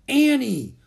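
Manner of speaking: high, loud warning call. A narrator's reading. Jack stops his sister saying too much, warning. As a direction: cut her off with her name. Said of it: "warned Jack".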